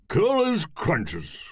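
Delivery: slow strongman exclamation; jolly strongman voice. Acted. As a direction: exclaim like a cheery giant. Mallow Man answering.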